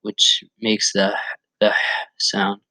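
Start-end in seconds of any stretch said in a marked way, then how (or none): 1.6-2.6 "h" pushed out as a breathy whisper from the throat. Shown as a demonstration. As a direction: push out air for the "h". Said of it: "push out the air from above your Adam's apple".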